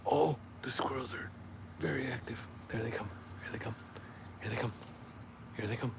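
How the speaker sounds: hushed whisper, watching wildlife; nature documentary whisper. Acted. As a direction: whisper like stalking animals on film. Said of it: "I feel like this is a nature documentary".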